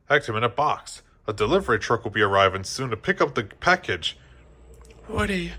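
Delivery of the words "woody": sad whisper. A narrator's reading. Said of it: "Jessie whispered sadly".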